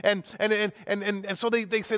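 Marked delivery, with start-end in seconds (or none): none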